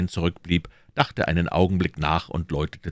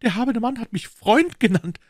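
higher, louder, fast thrilled outburst; overjoyed cry; 5 dB louder than usual. Acted. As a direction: burst out in delight. (überglücklicher Ausruf)

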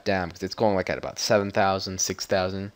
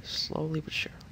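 whisper; 6 semitones higher, 11 dB quieter than usual. Pretend. Repeats phrase in shifting voices.

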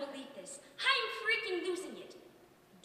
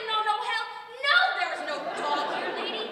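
louder, insistent no no no; emphatic denial; 6 semitones higher, 7 dB louder than usual. Acted. Snapping back at the neighbour lady, loud and insistent. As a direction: hammer the denial home.

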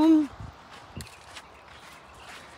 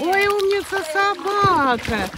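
gushing praise; 5 semitones higher, 3 dB louder than usual. Real, not acted. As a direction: coo over a good dog. Gushing over the dog after swimming.

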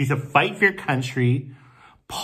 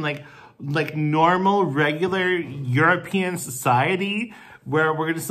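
ordinary talk, nothing marked

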